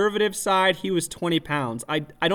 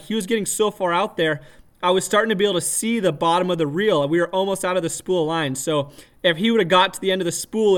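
ordinary talk, nothing marked

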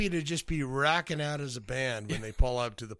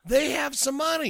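pitched higher, mock amazed; a bigger, impressed take on money; 9 semitones higher than usual. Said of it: "And then I saw Portia and I went".

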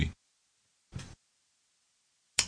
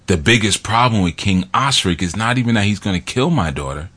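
usual speaking voice

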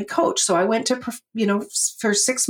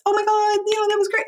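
put-on gushing girlfriend; 14 semitones higher, faster than usual. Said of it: "My girlfriend calls me and she says".

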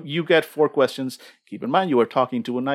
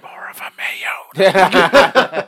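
loud, very drawn-out stage whisper; comic eerie answer, hugely stretched whisper; whispering knife; 6 semitones higher, 7 dB louder, much slower than usual. Acted. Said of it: "you distinctly hear the whisper back".

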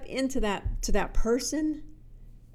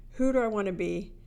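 normal talking voice

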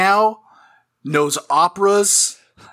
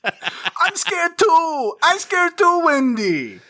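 high scared whine; 12 semitones higher, much slower than usual. Retold or imitated. Mocking scared hero.

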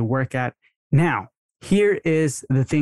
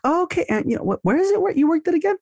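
much higher, breezy, innocent question; casual ask; faster than usual. Shown as a demonstration. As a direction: toss it off lightly, like you just forgot. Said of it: "you can easily ask".

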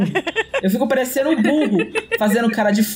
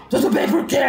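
irritated retort; 2 semitones higher than usual. Retold or imitated. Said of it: "A pessoa vira pra mim calmamente e fala assim".

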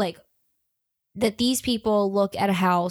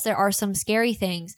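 ordinary delivery

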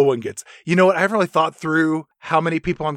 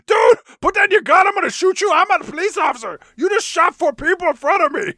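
much higher, louder hammed-up panic; panicked yelling; 5 dB louder than usual. Orc cop screaming at partner after the shooting, higher and louder. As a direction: scream at him to drop the gun. Orc yells at Will Smith.

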